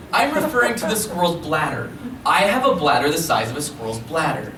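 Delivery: animated spoken word with swooping pitch; big, comic poetry performance for a crowd; comic slam poem. Acted. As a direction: perform it as an exaggerated awkward confession. Recites comic poem, announced as loudest.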